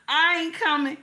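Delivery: put-on a sulking little girl refusing her husband; childish refusal. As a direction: sulk like a little kid. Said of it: "how a little kid do".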